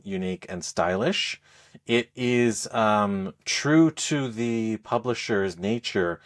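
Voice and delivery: normal talking voice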